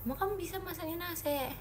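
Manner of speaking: mock doubtful; doubtful challenge. Shown as a demonstration. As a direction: challenge the viewer playfully. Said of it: "repeats it as a challenge".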